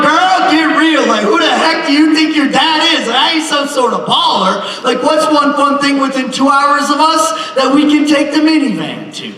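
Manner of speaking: high, loud, exasperated scolding; comic high-pitched loud rant; exasperated dad rant. Retold or imitated. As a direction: rant at her like a fed-up dad.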